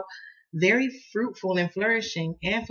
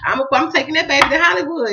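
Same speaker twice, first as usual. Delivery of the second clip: laughing quote; 5 semitones higher, 5 dB louder, faster than usual. Retold or imitated. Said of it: "I said".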